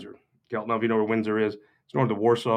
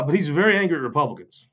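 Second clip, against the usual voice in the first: usual speaking voice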